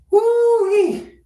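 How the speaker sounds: high, loud whoop of delight; excited whoop. Real, not acted. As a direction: cheer it out. Excited hair reveal cheer.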